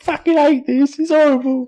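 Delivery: high put-on royal grumble; Henry the Fourth voice. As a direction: complain like a drenched king. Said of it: "we've both got the same Henry the Fourth voice".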